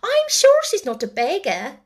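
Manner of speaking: high, loud, dismayed scolding; alarmed. A narrator's reading. Sister questioning brother over his sixpence, alarmed. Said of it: "Janet exclaimed alarmedly".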